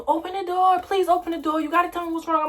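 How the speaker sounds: high, pleading mother's voice; pleading mom. Retold or imitated. Mother begging her daughter to open the bathroom door, high and pleading. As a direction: beg like a worried mother.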